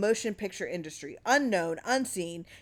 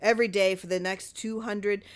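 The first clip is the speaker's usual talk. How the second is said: plain reading voice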